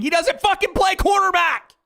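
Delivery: loud, high furious shout; furious real shout at the chat. Real, not acted. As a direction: yell it at the chat in disbelief. Ranting at chat.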